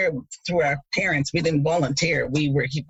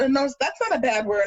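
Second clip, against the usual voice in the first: higher, innocent reassurance; lightly voiced tricking classmate; mock reassurance; 7 semitones higher than usual. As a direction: fool the kid. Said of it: "they were telling me".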